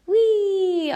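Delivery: high, happy "Wee" like a ride; gleeful wee. Shown as a demonstration. Teacher showing students the jet stream, high gleeful wee. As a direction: swoop up on a playful wee. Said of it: "we all go".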